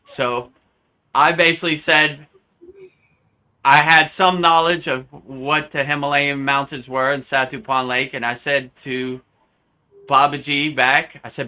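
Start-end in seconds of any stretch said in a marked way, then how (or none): none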